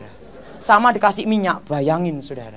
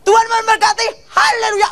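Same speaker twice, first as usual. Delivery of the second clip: imitated preacher's very high, sustained blessing; loud, high-pitched mock pulpit cry; Gilbert blessing the crowd; 4 dB louder than usual. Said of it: "langsung Gilbert gini". (tiruan seruan berkat pendeta, lantang dan tinggi)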